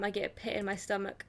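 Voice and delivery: ordinary delivery, nothing marked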